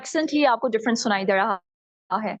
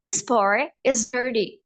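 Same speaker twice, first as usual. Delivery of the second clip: American accent; much slower than usual. Shown as a demonstration. Teacher models pot is dirty, American accent. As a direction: flap the T, slow American. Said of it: "This is American accent".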